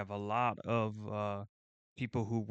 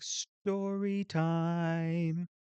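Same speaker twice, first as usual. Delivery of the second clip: high, long-drawn-out "story time" announcement; story time jingle; 7 semitones higher, much slower than usual. Shown as a demonstration. Streamer inventing a filter for his tangent, drawn-out high jingle voice. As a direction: stretch each word out in a raised mock-intro voice. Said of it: "like, a little filter for that".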